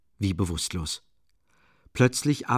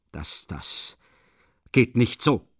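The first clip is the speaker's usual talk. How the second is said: halting stammer, broken start; slower than usual. A narrator's reading. Luschin stammers at the furious widow, halting. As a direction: stumble over the first words. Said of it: "stotterte Luschin".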